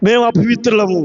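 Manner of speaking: low-pitched, subdued admission; low retold confession. Preacher voices spirits confessing uncleanness, low and restrained. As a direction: say it low and contained.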